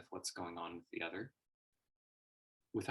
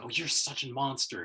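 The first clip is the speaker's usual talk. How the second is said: raised, higher accusing jab; hammed-up outraged accusation; mock accusation; 6 semitones higher, 8 dB louder than usual. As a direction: throw the accusation at them. Mock accusations thrown at speaker.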